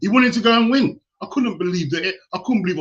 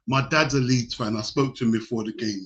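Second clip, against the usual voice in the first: ordinary delivery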